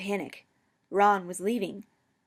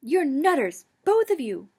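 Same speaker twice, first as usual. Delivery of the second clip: higher, louder sharp accusation; 7 semitones higher, 9 dB louder than usual. A narrator's reading. Ron accusing the two men sharply, louder. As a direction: throw the charge at them. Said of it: "Ron accused sharply".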